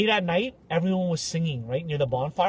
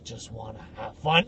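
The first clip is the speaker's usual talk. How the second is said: creepy repeat; 8 semitones lower, 6 dB quieter than usual. Retold or imitated. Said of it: "He's like".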